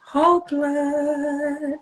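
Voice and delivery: slow, stretched-out announcing of whole; drawn out. Shown as a demonstration. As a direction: stretch Whole blood out like a product reveal. Showing the product on live.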